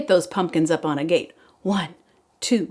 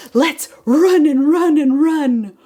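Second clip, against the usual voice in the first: louder, rushing to run; energetic pumpkin; 6 semitones higher, 7 dB louder, slower than usual. Acted. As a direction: call it out eagerly. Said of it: "The fifth one said".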